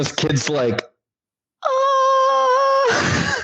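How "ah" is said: put-on high shouted yelp of a startled kid. Kid in the closet yelping in fright, high shout.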